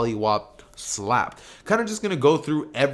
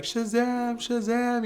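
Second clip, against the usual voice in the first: mock crowd scream; 10 semitones higher, much slower than usual. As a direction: chant it like a screaming crowd, held back. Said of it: "they'd all just be screaming it".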